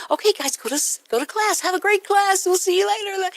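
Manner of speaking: high singsong cheery send-off; put-on happy. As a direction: sweetly wave the kids off with exaggerated cheer. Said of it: "everything's so happy".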